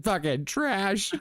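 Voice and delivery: slow, drawn-out mock of fucking trash; teasing slow contrast to doing good; mock gloomy answer. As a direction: drag it out to mock the bad day. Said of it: "My day was fucking horrible".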